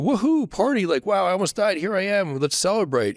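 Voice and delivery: mock party cheer. Mock party reaction to almost dying.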